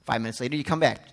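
usual speaking voice

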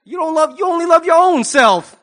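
high, loud, sassy child imitation; indignant little girl. Little girl on a TV show accusing loudly, indignant. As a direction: imitate a small girl's wounded accusation. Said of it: "that little girl on the Steve Harvey".

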